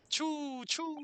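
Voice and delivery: very high squeaky mouse choo; hammed-up high mouse squeak. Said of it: "Only Japanese mice make a choo sound".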